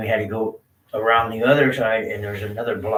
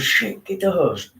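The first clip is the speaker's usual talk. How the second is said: slow, higher urgent command, imitating dad; 10 semitones higher than usual. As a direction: bark the order in a panic. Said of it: "he goes".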